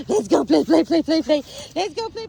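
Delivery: excited play call. Real, not acted. Owner hyping her dog to go play, in high excited calls.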